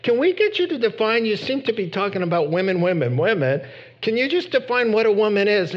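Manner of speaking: hammed-up interrupter demanding an answer; mock questioner.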